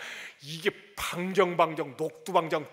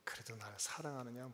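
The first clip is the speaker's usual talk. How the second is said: tender question; 8 semitones lower, 13 dB quieter than usual. Retold or imitated. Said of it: "물어보고 있는 것입니다".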